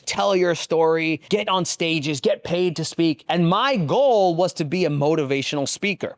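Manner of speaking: normal talking voice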